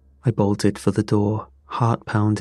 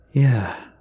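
ordinary delivery

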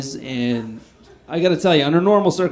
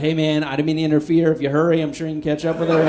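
hasty appeasing babble; faster than usual. Pretend. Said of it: "I'd be like".

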